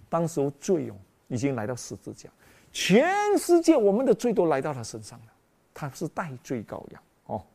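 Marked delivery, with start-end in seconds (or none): none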